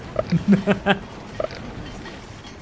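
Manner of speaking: airy unvoiced laughter; breathy laugh. Real, not acted. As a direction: laugh quietly through breath. Laughing at loud smithy.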